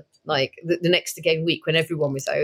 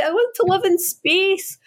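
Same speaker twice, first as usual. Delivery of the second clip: put-on high, slow childish wail; mock-tearful child, pitched way up; imitating younger self; 12 semitones higher, slower than usual. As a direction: whine it slowly like an upset kid. Voicing own tearful childhood self.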